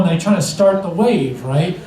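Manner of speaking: usual speaking voice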